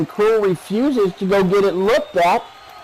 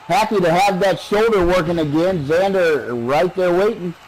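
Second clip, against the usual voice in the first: ordinary delivery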